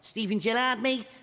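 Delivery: attempted Scouse. Shown as a demonstration. Brit doing a comic Scouse voice that comes out Brummie. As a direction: ham up a Scouser name-drop. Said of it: "that's not Scouse. That's actually, that's Birmingham".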